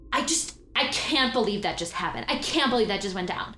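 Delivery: Trinity losing it over a broken promise; panicked outburst. Acted. GM voices panicking Trinity, rising to a loud wail.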